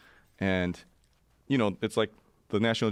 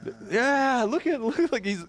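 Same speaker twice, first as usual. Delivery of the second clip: much higher, babbling villager voices; excited villagers. Retold or imitated. Villagers touching his face, high excited babble. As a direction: mimic excited villagers crowding around, squeaky high. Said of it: "They're like".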